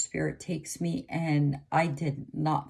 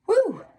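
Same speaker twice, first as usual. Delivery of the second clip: high, loud "whew" of release; emotional whew; 7 dB louder than usual. Real, not acted. Reader shaken by the child's pain, emotional whew. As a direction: blow out the tension in a high whew. Exhales after an intense moment.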